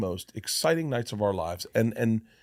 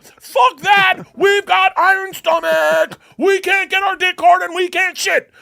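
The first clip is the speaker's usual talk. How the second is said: much higher, much louder shouting rant; high bellowing rant from the anxiety voice; Sam Kinison scream; 11 dB louder, slower than usual. Retold or imitated. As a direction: yell it like a raging comic screaming. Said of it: "Sam Kinison was in my head".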